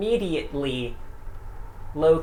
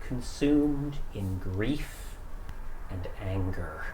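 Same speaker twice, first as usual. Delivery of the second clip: somber narration; 3 semitones lower than usual. Acted. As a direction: wind the story down gravely. GM closes the session.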